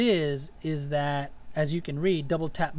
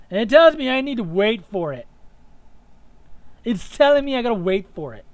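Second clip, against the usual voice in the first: loud exasperated; 7 semitones higher, 10 dB louder than usual. Real, not acted. Annoyed game blocks dodge.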